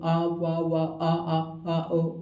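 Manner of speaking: very slow drawn-out babble; stretched baby-talk sounds. Shown as a demonstration. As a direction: babble slowly like talking to a baby. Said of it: "इशारा नहीं करना".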